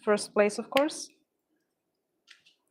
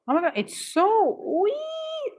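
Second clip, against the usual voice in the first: high, slow cooing over something tiny; aww, it's so tiny, high and drawn out; delighted squeal; 12 semitones higher, slower than usual. Real, not acted. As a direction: squeal with delight at how little it is.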